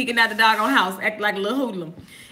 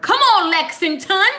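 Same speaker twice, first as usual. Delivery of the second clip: cheering a viewer; 7 semitones higher, 6 dB louder than usual. Real, not acted.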